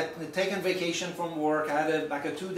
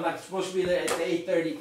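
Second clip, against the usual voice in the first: usual speaking voice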